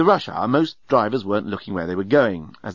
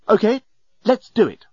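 higher, firm sudden resolve; decisive; 10 semitones higher, 4 dB louder than usual. A narrator's reading. Nettie giving the journalist the go-ahead, decisive. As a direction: take charge decisively. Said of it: "Nettie suddenly sounded decisive".

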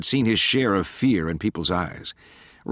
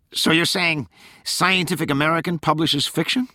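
high, incredulous question; raised-pitch sceptical needling; sceptical challenge; 8 semitones higher than usual. A narrator's reading. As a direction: challenge it with mock-innocent doubt, pitched up. Corky challenges Tolland with the magazine.

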